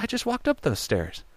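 high, soft, startled thought; hushed disbelief. Retold or imitated. Voice actor recognizing the stairs on screen, hushed disbelief. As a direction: say it small and high, as it dawns on you. Dawning realization in his head.

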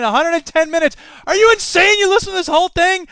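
high, loud incredulous squawk; hammed-up outrage at the episode length; mock disbelief. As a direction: pretend to be shocked at the listener.

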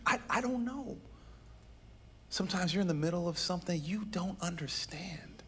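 soft, near-whispered admission; hushed confession. Real, not acted. Pastor confessing to the church, hushed. Pastor admits grief over prayers.

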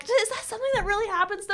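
put-on high, fast, giggling like a little kid; mock childlike giggly voice. Friend mocking a childlike heroine, giggly high voice. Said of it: "And I'm like".

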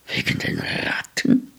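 whispered, incredulous question; hushed disbelief. A narrator's reading. Man steps back at the boy's reason, hushed disbelief. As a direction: echo it under the breath as a question. Man echoes the boy, taken aback.

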